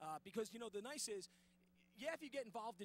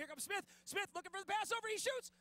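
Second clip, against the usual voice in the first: excited goal call; 9 semitones higher, 8 dB louder than usual. Acted. Goal call in mock hockey broadcast.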